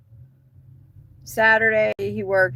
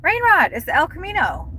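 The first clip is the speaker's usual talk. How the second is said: excited call; 10 semitones higher than usual. Acted. Calling out excitedly.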